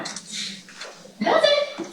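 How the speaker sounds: doubtful little squeak at the AI; skeptical doubt. Retold or imitated. Doubting the AI's two-minute claim, high mock disbelief. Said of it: "I'm going".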